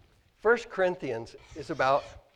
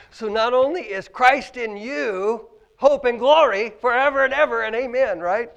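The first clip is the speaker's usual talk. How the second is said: preaching; 7 semitones higher, 12 dB louder than usual. Acted. Preaching builds.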